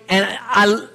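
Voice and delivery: ordinary talk, nothing marked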